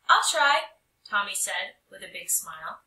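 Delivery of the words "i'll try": high, loud happy promise; eager. Acted. Tommy promises the art teacher he'll try, eager. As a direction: answer brightly.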